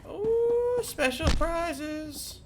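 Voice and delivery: high, slow drawn-out ooh; mock delight. Gamer finds loot in the vault: high, slow, delighted coo.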